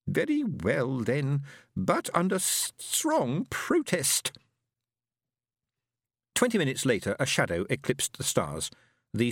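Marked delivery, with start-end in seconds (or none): 0.1-4.3 high, slow reluctant alien; grudging alien. Acted. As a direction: give in under protest. Fenobe grudging consent.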